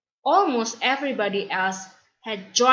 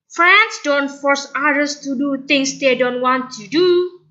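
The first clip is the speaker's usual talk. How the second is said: higher, louder firm reasoning; firm friend; 5 semitones higher, 4 dB louder than usual. Acted. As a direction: set her straight firmly. Bolan arguing.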